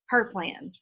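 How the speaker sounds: usual speaking voice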